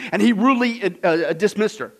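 ordinary delivery

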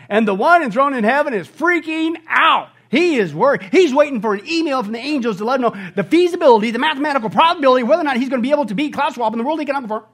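loud, high, driving sermon voice; fiery preaching. Acted. Preacher rousing the congregation about God, loud and fired up. Preaches the psalm at full force.

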